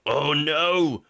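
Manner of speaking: drawn-out, hammed-up dismay; mock horror. Host jokes about having lived in sin, mock horror. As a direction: wail it in pretend horror.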